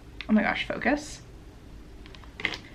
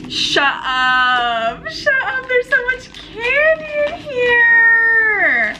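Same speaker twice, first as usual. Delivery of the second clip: high, drawn-out squeal of delight; gasping over a gift, stretch it into a squeal; thrilled disbelief; 13 semitones higher, 4 dB louder, much slower than usual. Real, not acted. Opening a gift parcel full of candy.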